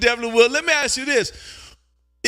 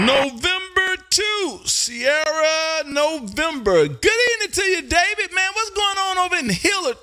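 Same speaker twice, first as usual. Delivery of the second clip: hearty radio hail; 6 semitones higher than usual. Real, not acted. Hails a known caller by callsign.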